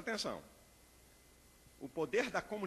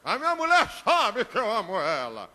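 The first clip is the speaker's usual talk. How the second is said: 1 smug husband; 4 semitones higher, 12 dB louder than usual. Retold or imitated. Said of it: "husband boasting about wife".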